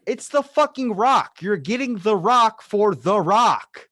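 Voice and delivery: high, loud and slow, disbelieving; exasperated outburst. Retold or imitated. Mocking casting critics, loud slow exasperation. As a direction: spell it out slowly, fed up. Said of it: "I'm like".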